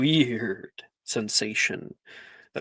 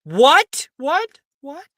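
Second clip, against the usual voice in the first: loud, high, rising what's; dismayed outburst; 12 semitones higher, 8 dB louder, much slower than usual. Acted. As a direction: cut through the applause in disbelief. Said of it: "[name]'s voice cut through".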